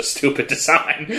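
real laugh breaking into the words; laughing mid-sentence. Real, not acted. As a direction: crack up while criticising. Cracking up at the costume.

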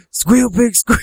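high, loud mock taunt; hammed-up high jeer; squealing taunt. Quoting a taunt to squealing son.

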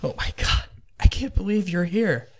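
带哭腔的惊喜; tearful relief. Acted. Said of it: "he's sobbing quietly".

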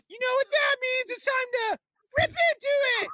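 high, squeaky cartoon cookie shouting; gingerbread man voice. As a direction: screech it like a hyper little cookie man. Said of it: "Do the rip into it in the gingerbread man voice".

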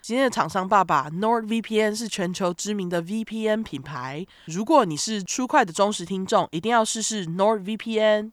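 upbeat sponsor ad read; bright, selling ad voice; infomercial. Acted.

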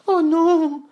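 very high, loud little goat voice; high-pitched cry of alarm; little billy goat, alarmed. Acted. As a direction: squeal it as a frightened small goat. Voices Petit Bouc in the tale.

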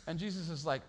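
ordinary delivery

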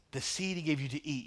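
ordinary delivery

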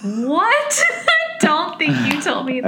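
high, loud "What?"; surprised. Real, not acted. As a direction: caught off guard, disbelieving. Surprised at career result.

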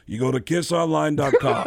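bossy put-on prank voice. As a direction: lay down the instructions, mock-stern. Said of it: "if you called him as [name]".

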